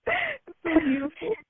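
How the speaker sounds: high, teary-sounding fake sob over a podcast goodbye; mock choked up. Cohost teasing the heartfelt holiday messages, in a mock teary voice. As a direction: ham up being all choked up, half joking. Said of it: "I'm all choked up".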